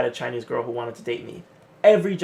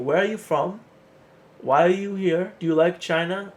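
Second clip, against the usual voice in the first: curious friendly locals; 5 semitones higher, 4 dB louder than usual. Retold or imitated. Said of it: "wanna talk to you".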